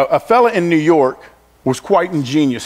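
ordinary talk

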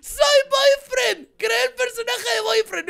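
high, loud, stretched-out cry of triumph; thrilled shout. Real, not acted. Player finishes her costume, shouting with delight.

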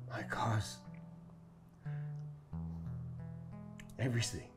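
very low, hushed voice of a broken man; despondent. Acted. Man mourns all he lost.

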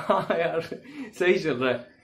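a laugh then a light easy answer. Shown as a demonstration. Friend answers how he is, laughing it off. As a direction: laugh your way through the reply. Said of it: "हंस के ही आपने कहा ना".

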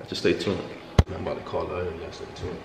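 hushed whisper before the prank. Real, not acted. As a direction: whisper so she can't hear. Hiding before calling girlfriend.

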